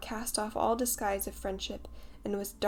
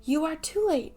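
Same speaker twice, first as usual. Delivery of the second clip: high, louder boastful cry; proud cry; 8 semitones higher, 6 dB louder than usual. A narrator's reading. Tootles boasting to the boys over the fallen bird, proud. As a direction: crow over the others. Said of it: "he cried proudly".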